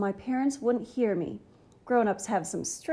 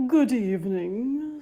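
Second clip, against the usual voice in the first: lightly voiced low, breathy monster greeting; a gentle monster breathing out a hello; 3 dB louder than usual. A narrator's reading. Monster greets the boy in a low breathy voice. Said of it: "said a low, breathy voice".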